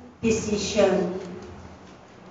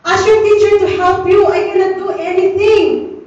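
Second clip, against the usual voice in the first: higher and louder, pressing the point; raised insisting voice; 6 semitones higher, 4 dB louder than usual. Retold or imitated. Mother to son about homework, raised and insistent. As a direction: push it on him, louder this time.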